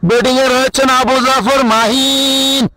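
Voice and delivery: comic cricket commentary. Acted. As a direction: ham up the commentary for laughs. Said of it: "দর্শককে হাসিয়েছেন". (মজার ধারাভাষ্য)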